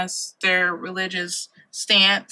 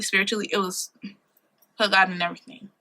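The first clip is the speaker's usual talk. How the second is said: ordinary talk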